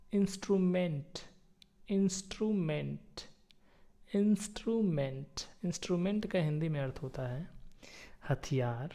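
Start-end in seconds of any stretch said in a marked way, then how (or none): 0.1-1.1 "इंस्ट्रूमेंट" said as a clear model word; pronunciation demo. Shown as a demonstration. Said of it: "सही pronunciation होता है". 1.9-3.2 "इंस्ट्रूमेंट" slow and higher, as a model; pronunciation demo. Shown as a demonstration. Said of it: "सही pronunciation होता है". 4.2-5.3 "इंस्ट्रूमेंट" pitched higher, as a model word; pronunciation demo. Shown as a demonstration. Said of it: "सही pronunciation होता है".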